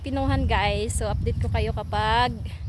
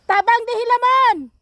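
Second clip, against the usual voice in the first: high, loud long-distance call; shouting; 12 semitones higher, 8 dB louder than usual. Real, not acted. Calling a cousin to come help weed, shouting. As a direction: holler for help across the field. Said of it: "sinigawan ko".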